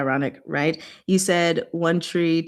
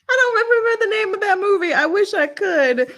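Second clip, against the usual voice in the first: much higher, laughing through the words; 13 semitones higher, 3 dB louder, faster than usual. Real, not acted. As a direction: giggle it out, fast and high. Laughing at the crazy movie.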